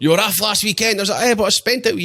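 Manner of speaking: high, loud quoting of the missus; retold row. Telling his mate about the missus's complaint, high and loud.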